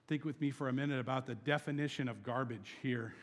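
ordinary delivery

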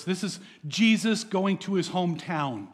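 ordinary delivery

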